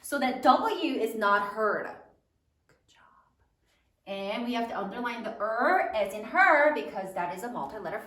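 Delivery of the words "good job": barely audible whisper; hushed, voiceless aside; whispered praise. Real, not acted. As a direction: whisper it as quiet encouragement. Teacher softly praises the students.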